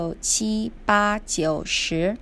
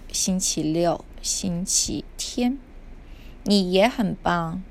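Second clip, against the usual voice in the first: usual speaking voice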